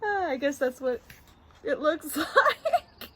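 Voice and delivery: high voice dissolving into laughter; laughing. Real, not acted. Reader cracks up over the story, really laughing. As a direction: talk through a real giggle fit.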